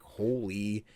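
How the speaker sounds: stunned awe. Retold or imitated. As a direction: stretch each word in hushed shock. Retold awe at sudden death.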